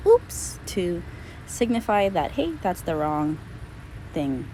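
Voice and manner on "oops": a high, light "Oops" cue; oops marker. Shown as a demonstration. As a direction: flag the mistake with a bright "Oops". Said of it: "you'll hear me say".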